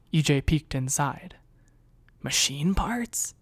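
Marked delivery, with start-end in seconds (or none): 2.2-3.3 slow, high, unvoiced whispered question; puzzled whisper. A narrator's reading. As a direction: whisper it, surprised and curious. Whispering while hiding.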